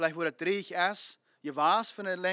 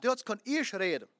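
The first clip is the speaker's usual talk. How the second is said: ordinary delivery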